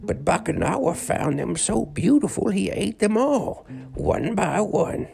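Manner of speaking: a tired ancient sage; old man voice. Acted. Old man tells legend.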